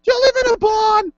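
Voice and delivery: very high, loud scolding mother; hammed-up mom telling off her son in falsetto; mom impression. Host as co-host's mother scolding Andrew, high loud mom impression. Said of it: "That's my impersonation of your mother".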